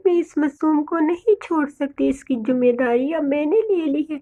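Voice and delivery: softly voiced protective mother bird; tender mother bird. Bird vows to care for orphan chick, tender and soft.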